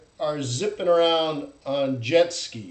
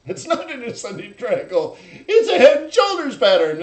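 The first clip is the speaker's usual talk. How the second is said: mock alarm; 5 semitones higher, 4 dB louder than usual. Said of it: "and you're like".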